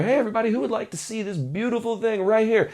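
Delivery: eager showman. Shown as a demonstration. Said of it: "what I was doing earlier".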